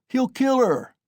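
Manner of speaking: very high alarmed cry; shouted. A narrator's reading. Another onlooker crying out that he will kill her, very high cry. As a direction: shriek the warning across the crowd. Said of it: "was shouted round him".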